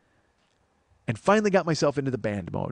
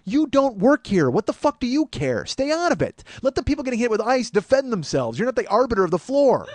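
self-scolding yell; 9 semitones higher, 5 dB louder than usual. Retold or imitated. Inner voice telling himself to stay out of it, loud scolding. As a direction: yell at yourself to back off. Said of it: "in my head I go".

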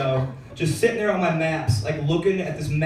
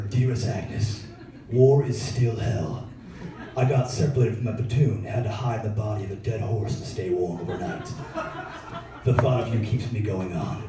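hammed-up solemn soldier writing home; old-timey soldier letter; 7 semitones lower, 4 dB quieter than usual. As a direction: read it like a grave wartime love letter. Grandpa's wartime love letter voiced.

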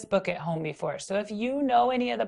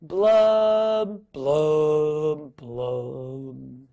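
falling blub; 4 semitones lower, 4 dB louder, much slower than usual. Shown as a demonstration. Teacher leading kids in the droopy blub. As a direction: drop each blub down the scale. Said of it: "This is the part I need your help with".

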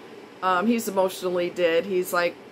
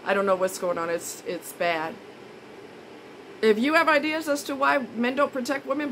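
ordinary talk

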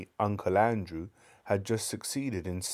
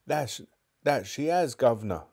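bystander agreeing; 5 semitones higher, 4 dB louder than usual. Acted. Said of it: "said one of the crowd".

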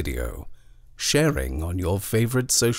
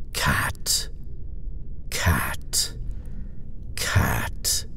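cold, toneless whisper, softer; cruel flat whisper; 7 semitones lower, 3 dB quieter, much slower than usual. A narrator's reading. Nightmare creature hissing at the child, cruel toneless whisper. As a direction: breathe each cat out without voice. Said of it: "a voice cruel and flat says".

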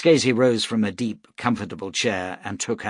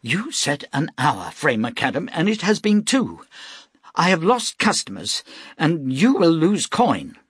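higher, louder angry scolding; angry rebuke; 8 semitones higher, 4 dB louder than usual. A narrator's reading. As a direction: dress down a late worker. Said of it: "his face red with anger".

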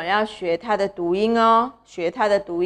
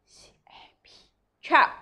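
whispered demo; 4 semitones higher, slower than usual. Shown as a demonstration. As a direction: whisper C A P, then chop. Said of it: "你们读在心里". (轻声耳语示范心里默读)

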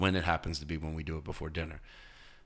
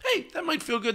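higher delighted wow; pleasantly surprised; 5 dB louder, slower than usual. Retold or imitated. A newcomer after the towel, delighted surprise. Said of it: "if they go".

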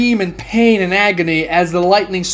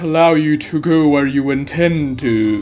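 masked figure, calm and cold; 3 semitones lower than usual. A narrator's reading. Masked stranger warning ninjas off, cold and calm. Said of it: "stares at them and says".

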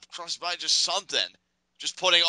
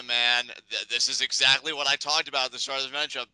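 normal talking voice

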